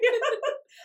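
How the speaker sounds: high nervous laugh holding back tears; choked-up laugh; teary laugh. Real, not acted. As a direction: let out a teary giggle. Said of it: "trying not to cry".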